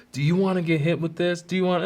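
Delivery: high mock stern principal; principal threatening. Principal threatening a crying kid, mock stern. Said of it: "and he's like".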